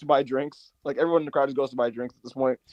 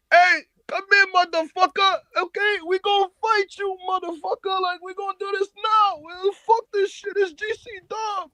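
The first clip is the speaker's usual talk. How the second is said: Homicide mic rant. Retold or imitated. Homicide cutting in on a long promo, high mock yell. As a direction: imitate a wrestler ranting, pitch way up.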